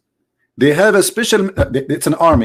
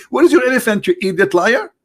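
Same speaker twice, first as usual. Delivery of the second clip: mock scream; 9 semitones higher than usual. Man taunting Allah about the missing elephants, mock scream. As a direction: jeer it up high as a retold taunt. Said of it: "he was screaming at Allah".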